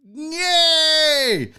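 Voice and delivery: high, loud sarcastic yay; yay teasing the viewers who gave thumbs down; mock cheer.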